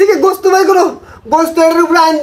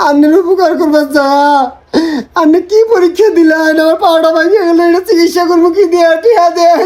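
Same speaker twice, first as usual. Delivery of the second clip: put-on sobbing plea; hammed-up whine for sympathy; tearful begging. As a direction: beg through fake tears. Begging money with fake injury.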